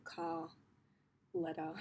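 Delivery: "car" as CAH and "letter" as LET-uh, Australian style with no R; no R sound. Shown as a demonstration. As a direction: drop the R at the end of "car" and "letter". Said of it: "don't pronounce the letter R".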